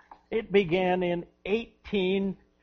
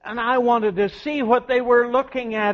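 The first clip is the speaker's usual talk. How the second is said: normal talking voice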